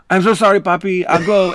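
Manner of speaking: lightly voiced apologetic teen; apologetic kid. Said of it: "He was going".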